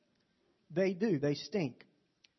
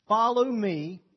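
shouted out, ringing and much louder; Jesus' command thundered from the pulpit; booming scripture call; 5 semitones higher, 10 dB louder, much slower than usual. Acted. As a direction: proclaim it to the whole church. Preacher booms Jesus' call.

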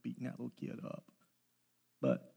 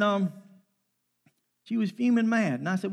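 ordinary delivery

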